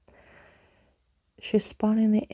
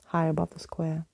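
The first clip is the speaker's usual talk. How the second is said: ordinary delivery, nothing marked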